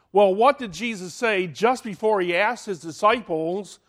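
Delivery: usual speaking voice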